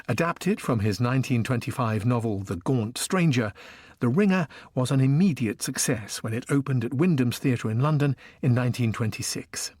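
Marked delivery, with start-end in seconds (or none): none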